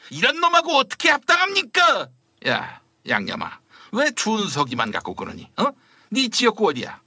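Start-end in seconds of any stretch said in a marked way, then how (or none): 0.1-2.1 indignant shout. Acted. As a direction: yell it in offended disbelief. Outraged minister protests insult.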